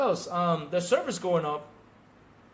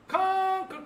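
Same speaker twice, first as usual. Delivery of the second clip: mocking call; 3 dB louder than usual. Real, not acted. Mocking failed game.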